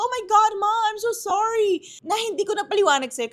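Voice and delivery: high, loud flustered sorry to her mother; frantic apology. Retold or imitated. Daughter apologizing to her mama for the invitation, flustered and high.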